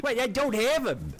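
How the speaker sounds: startled protest. Real, not acted. Protesting accusation.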